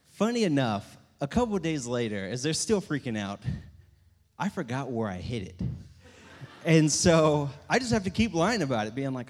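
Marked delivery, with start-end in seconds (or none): none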